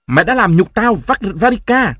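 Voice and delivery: high, loud bellow, voice cracking; cracking scream. A narrator's reading. Grandfather howls at his daughter, voice cracking. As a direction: scream until the voice breaks. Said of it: "gào lên, giọng lạc hẳn đi".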